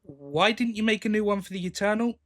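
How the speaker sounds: ordinary talk, nothing marked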